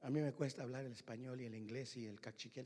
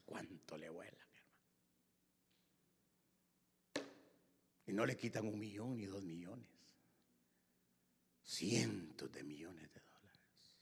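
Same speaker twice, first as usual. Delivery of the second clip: low hushed whisper; quiet confidential sermon; 6 semitones lower, 7 dB quieter than usual. Acted. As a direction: drop to a whisper.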